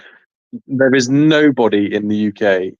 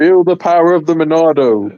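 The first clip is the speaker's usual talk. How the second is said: higher, louder heroic battle cry; heroic game quote; 7 semitones higher, 5 dB louder than usual. Retold or imitated. Friends quoting a game hero, mock heroic call. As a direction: declaim it like an anime hero. Quoting Xenoblade hero's line.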